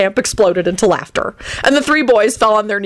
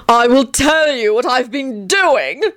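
high, slow theatrical confession; anguished cry; 6 semitones higher, slower than usual. Acted. Antonin hides his face to confess, high slow wail. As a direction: announce it in drawn-out anguish. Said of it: "Antonin cried".

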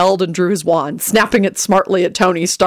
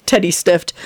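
ordinary delivery

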